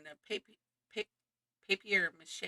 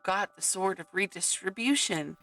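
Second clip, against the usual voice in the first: grand storybook narrator voice; rousing fantasy narration; epic story narration; 7 dB louder than usual. Acted. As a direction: announce the quest like a fairy tale. Narrates game story over music.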